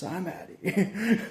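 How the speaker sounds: mock walkout. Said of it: "I'm like".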